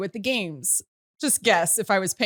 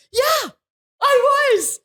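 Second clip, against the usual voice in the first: proud excited yes; 6 dB louder, much slower than usual. Retold or imitated. Proudly answering on the bus.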